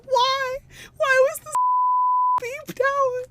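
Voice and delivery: high, loud mock-indignant squeal; outraged disbelief. Real, not acted. Streamer protesting the bleep, high squeal.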